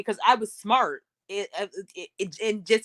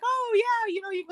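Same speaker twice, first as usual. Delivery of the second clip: put-on a much higher, sing-song teasing kid voice; mocking kids. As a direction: mimic playground kids making fun of her. Kids mocking her speech.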